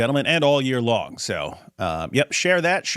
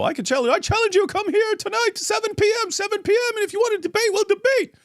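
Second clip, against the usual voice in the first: mocking preacher voice. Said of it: "retelling the challenge".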